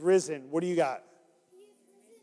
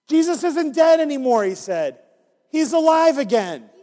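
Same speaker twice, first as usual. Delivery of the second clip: higher, louder joyful news; joyful announcement; 6 semitones higher, 5 dB louder than usual. Acted. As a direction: proclaim it with delight.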